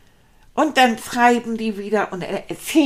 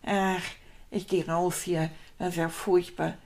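lower, softer, giving up; defeated mumble; 6 semitones lower, 9 dB quieter than usual. Acted. Rat gives up on the messy kitchen, defeated and soft. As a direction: mutter like you are walking away from it all.

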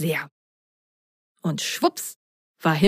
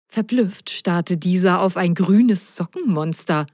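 usual speaking voice

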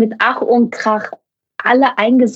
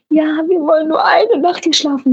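pleading; 8 semitones higher than usual. Retold or imitated. Crew pleading with driver.

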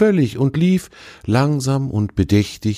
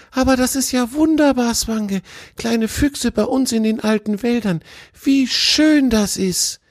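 put-on much higher, thrilled young deer; excited fawn. A narrator's reading. The fawn rejoices at the fox's news, high and excited. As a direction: burst out with joy, high. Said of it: "Auch Tjawe geriet in Aufregung".

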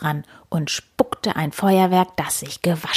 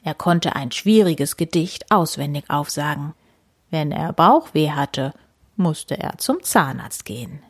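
ordinary delivery

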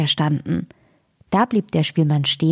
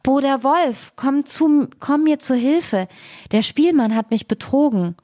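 high, pleading fox calling his brother; wailing cry for help; 8 semitones higher than usual. A narrator's reading. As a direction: cry out desperately for rescue. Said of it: "fing er an zu jammern und schrie aus Leibeskräften".

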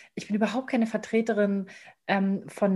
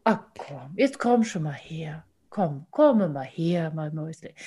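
calm, soothing grandfather; 5 semitones lower, slower than usual. Retold or imitated. Grandfather comforts upset grandchild, lower, slow and tender. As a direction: lull a child down gently. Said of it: "hat er sich neben mich gesetzt".

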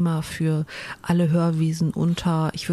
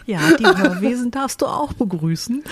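laughing; 6 semitones higher, 4 dB louder than usual. Real, not acted. Teasing partner who is laughing.

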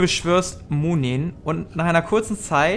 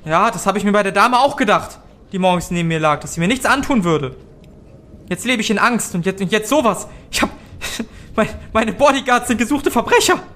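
frightened innkeeper; faster than usual. Acted.

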